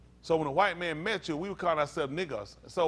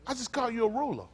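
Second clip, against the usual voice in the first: ordinary delivery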